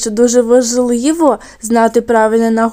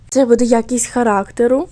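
normal talking voice

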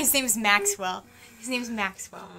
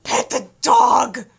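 loud, slow excited shout; 8 dB louder, slower than usual. Real, not acted. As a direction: yell it at the screen. Shouting command at game.

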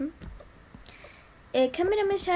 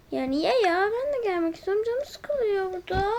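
high whining, begging to come; whiny pleading; 5 semitones higher than usual. Acted. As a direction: whimper like a bored kid.

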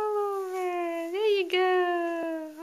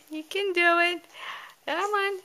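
normal talking voice

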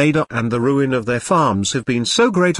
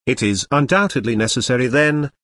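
plain reading voice

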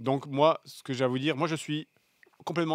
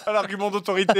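laughing; 6 semitones higher, 7 dB louder than usual. Real, not acted. Teasing retort, laughing.

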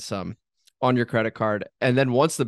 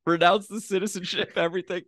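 talking through laughter, higher; laughing; 7 semitones higher than usual. Real, not acted. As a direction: giggle out the words as a joke. Laughing while imagining owner fleeing.

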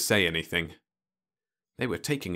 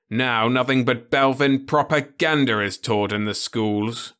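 higher, louder grim telling; bitter old man; 5 semitones higher, 6 dB louder than usual. Acted. Osmond tells Ludovic of the rewritten textbooks, bitter. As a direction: pass on the old grudge.

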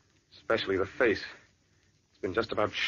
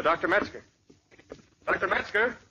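higher, calling into the empty lab; 7 semitones higher than usual. Acted. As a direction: call for him, rising worry.

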